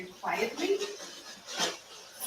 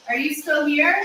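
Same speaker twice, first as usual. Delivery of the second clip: loud raised call into the dark; 6 semitones higher, 11 dB louder than usual. Real, not acted. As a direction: call out to an unseen spirit. Calling out to a spirit.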